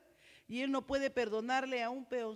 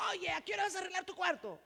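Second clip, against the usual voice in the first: parent yelling; 7 semitones higher, faster than usual. Shown as a demonstration. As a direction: yell at the kid about his room. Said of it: "qué nuevas maneras de hablar deben reemplazar".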